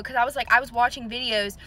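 ordinary talk

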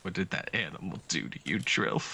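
mock tender concern. Consoling pet, low voice.

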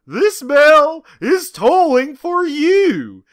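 high, slow, loud heroic call; heroic cry. Acted. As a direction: ring it out like a war cry. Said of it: "cried out John Arbuckle".

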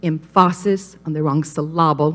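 "emphasis" as em-FAH-sis, "syllable" as sil-LAH-bul; low, deliberate misplaced stress on the middle of "emphasis" and "syllable"; wrong-syllable stress. Shown as a demonstration. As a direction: lean on the second syllable of "emphasis" and "syllable". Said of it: "One little accent mark".

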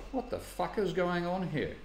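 half-whispered shock at what he sees; baffled, muttered under the breath; retold disbelief. Baffled by the graphed numbers.

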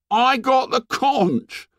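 put-on high, slow hurt boy voice. A narrator's reading. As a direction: plead with wounded pride. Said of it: "said Piggy in a hurt voice".

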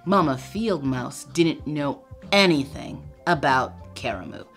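usual speaking voice

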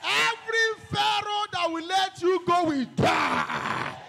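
rising chant of hey into loud, high decrees; fiery prayer shout. Acted. Preacher leading a warfare prayer, a building roar. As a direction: build from a call into a roaring declaration.

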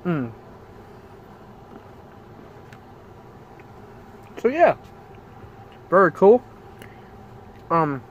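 talking with food in mouth; mumbled through a bite; chewing while talking. Real, not acted. Seen 1: Bit cookie at -7; jaw still chewing, lips tight during words.

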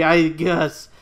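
ordinary talk

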